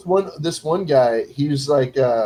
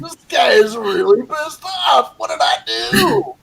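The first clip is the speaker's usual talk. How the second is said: higher, exclaimed retold dismay; hammed-up "what did I do" panic; mock bewilderment; 6 semitones higher than usual. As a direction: play up your baffled alarm, climbing high at the end. Acts own baffled reaction to rage.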